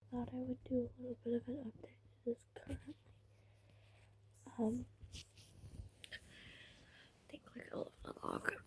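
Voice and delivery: hushed late-night whisper in bed. Real, not acted. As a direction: whisper so nobody wakes.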